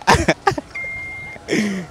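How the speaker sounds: embarrassed burst of laughter; laughing. Real, not acted. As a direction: laugh at your own mistake. Answerer laughs at own mistake.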